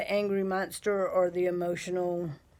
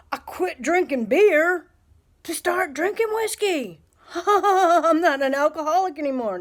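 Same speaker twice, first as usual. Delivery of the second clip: high, loud mocking voice with a laugh; mock drunk logic; 9 semitones higher, 8 dB louder than usual. Mocking vapers who think they quit, high and laughing. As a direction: lampoon the smug quitter, laughing through it. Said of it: "It's like".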